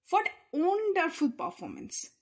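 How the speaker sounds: delighted praise; admiring exclamation. Shown as a demonstration. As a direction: sound impressed. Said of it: "I am giving stress".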